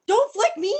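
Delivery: put-on gasp, then high, loud little-child protest; frightened inner child. Inner child begs the coach not to flick her, high alarmed plea. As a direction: squeal it like a child about to be flicked. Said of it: "the inner child on my shoulder was like".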